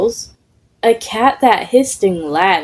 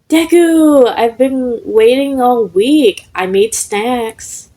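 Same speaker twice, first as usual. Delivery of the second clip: high, slow, delighted ghost voice easing down; thrilled to finally see him; eager ghost; 5 semitones higher, 3 dB louder, slower than usual. Acted. Ghost welcomes Deku after a week, high and eager.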